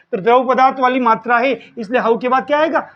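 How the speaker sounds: ordinary talk, nothing marked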